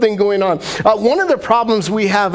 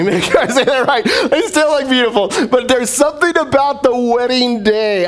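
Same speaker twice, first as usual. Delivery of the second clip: higher, laughing backpedal; laughing correction; 7 semitones higher than usual. Real, not acted. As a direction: catch yourself and laugh it off. Laughing correction about beautiful brides.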